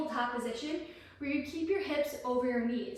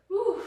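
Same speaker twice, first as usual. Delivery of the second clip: higher exhale of relief; relieved whew; 6 semitones higher, 3 dB louder than usual. Real, not acted. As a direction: blow out the effort. Relief after the set.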